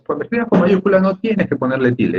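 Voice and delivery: lightly voiced parent; imitating parents' rule. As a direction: recite it like a parent instructing a child. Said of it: "yo escuchaba a mis padres".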